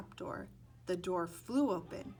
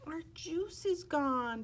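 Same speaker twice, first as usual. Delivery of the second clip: put-on higher, slow, sniffling little girl; sniffly sad; 8 semitones higher, 4 dB louder, slower than usual. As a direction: say it like you're about to cry. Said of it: "Della said with a sniffle".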